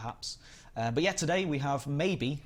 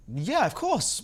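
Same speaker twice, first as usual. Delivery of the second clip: 1 eager yes; 11 semitones higher, 7 dB louder, much slower than usual. Real, not acted. Eager permission reply.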